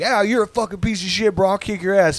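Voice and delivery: high-pitched mock trash talk; hammed-up tough-guy bluster; generic blustering threat. Shown as a demonstration. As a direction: parody anyone picking a fight. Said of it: "'Cause anybody can be like".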